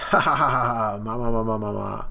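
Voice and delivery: hammed-up giant's laugh; mock mamama laugh. Imitating Big Mom's laugh, a hammed-up mamama chuckle. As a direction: chuckle ha ha then mama ma rolling.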